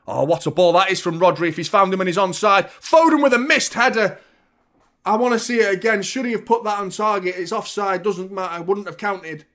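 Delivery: loud, high match call, gradually settling; excited live commentary. Real, not acted. Commentator calling Foden's missed header, excited, then settling. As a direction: burst out at the chance, then ease off.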